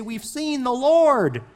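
high, loud and slow burst of joy; excited. Retold or imitated. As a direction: shout the news with joy. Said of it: "and they are excited".

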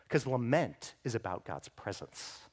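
ordinary talk

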